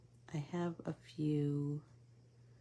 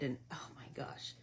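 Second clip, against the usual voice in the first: breathless unvoiced whisper, much softer; whispered gush; 3 semitones lower, 9 dB quieter than usual. Real, not acted. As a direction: confide it in a sudden whisper. Gushing aside in a whisper.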